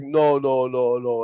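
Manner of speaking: normal talking voice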